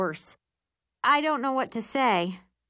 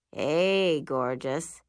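deep, manly voice; low, smooth boyfriend greeting; Will; 5 semitones lower, much slower than usual. A narrator's reading. Boyfriend greeting his girlfriend on the phone, deep and manly. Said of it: "says Will's deep, manly voice".

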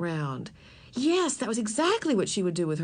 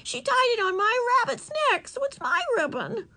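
much higher pleading insistence; upset girl insisting, much higher. Acted. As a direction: make them see.

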